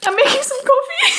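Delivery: very high, squeaky laugh-through-tears voice; tearful laughing. Real, not acted. Vlogger failing to hide a cry over coffee, tearful laughing. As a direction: lose the composure and squeak it out laughing. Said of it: "Pretending I didn't just cry".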